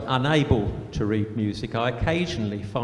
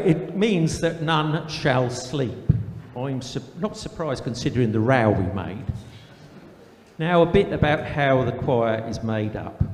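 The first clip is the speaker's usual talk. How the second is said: put-on broad country accent, letter read aloud; put-on rural accent, comic storytelling. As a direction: read it as a rustic cousin with a rural English accent. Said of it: "best read in a rural accent".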